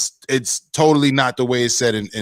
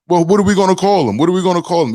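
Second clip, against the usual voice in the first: higher, louder, hurried mock protest; mock stalling; 7 semitones higher, 6 dB louder, faster than usual. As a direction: imitate someone dodging with a quick question. Acting out Trump's stalling reply.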